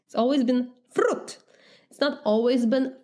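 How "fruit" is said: "fruit" higher, with a trilled r and short vowel; rolled R. Shown as a demonstration. Telling of the household habit, "fruit" with a rolled r. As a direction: roll the r in "fruit". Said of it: "We always roll our R's".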